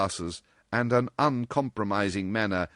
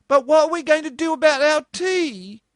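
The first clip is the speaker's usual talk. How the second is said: much higher and louder theatrical wail; 9 dB louder than usual. Acted. Marjorie fretting over the missing tea, hammed-up wail. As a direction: moan about the tea like a lost cause. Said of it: "wailed Marjorie".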